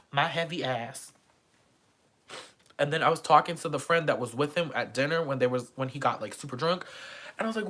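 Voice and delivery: usual speaking voice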